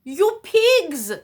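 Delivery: put-on loud high Cuban-accented outburst; Ricky Ricardo voice. Father catching the kids eating cake, loud accented shout. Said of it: "in his Ricky Ricardo voice".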